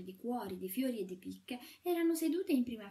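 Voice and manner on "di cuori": plain reading voice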